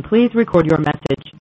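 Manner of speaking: ordinary talk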